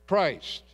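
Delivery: "Christ" set apart and leaned on with a falling close; stress on Christ. Shown as a demonstration. Pastor stressing "Christ" to his congregation. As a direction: hit "Christ" on its own, then pause. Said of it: "I want to emphasize the word Christ".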